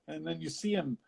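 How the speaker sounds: ordinary talk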